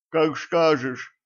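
slurred sibilants, drawn out; lisping. A narrator's reading. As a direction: lisp it like a man with a battered jaw. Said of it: "прошепелявил Тим".

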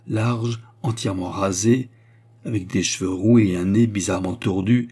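ordinary delivery, nothing marked

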